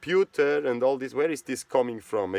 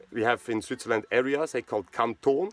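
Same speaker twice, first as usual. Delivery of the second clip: ordinary talk, nothing marked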